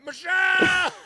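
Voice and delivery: high yelling call across a crowded club; calling out a name. Retold or imitated. A worried dad calling for his daughter in a crowd, high yell. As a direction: cry out the name like a lost parent. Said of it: "walking around going".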